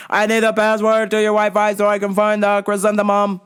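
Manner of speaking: mock deaf man's monotone demand; yelling deaf customer. Deaf customer demands password from worker, imitated yelling. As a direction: imitate a deaf customer over-projecting on one note. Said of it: "Just yelling, man".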